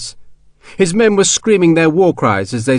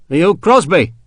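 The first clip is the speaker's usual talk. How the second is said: irritated; 4 semitones higher than usual. A narrator's reading. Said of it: "in an irritated voice".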